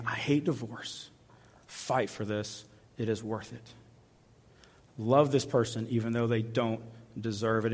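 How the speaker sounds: soft, low and earnest; quiet voice of God. Acted. God quietly pleading with a struggling spouse. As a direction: urge them gently, almost hushed. Preacher voicing God on marriage.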